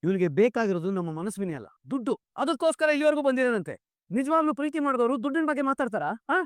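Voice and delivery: a father exposing a money-hungry groom, angrily; angry accusation. Acted. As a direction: hurl the charge of greed at him.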